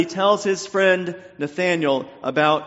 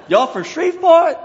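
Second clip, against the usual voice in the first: loud, high-pitched Cajun cartoon voice; Ray the Cajun Firefly; 14 semitones higher, 9 dB louder than usual. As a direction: holler it in a hammed-up Cajun accent. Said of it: "I sound like Ray the Cajun Firefly".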